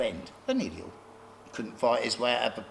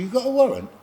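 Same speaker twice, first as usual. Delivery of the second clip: defiant challenge; 11 semitones higher, 4 dB louder, faster than usual. Retold or imitated. Defying police at the door.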